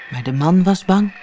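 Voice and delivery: plain reading voice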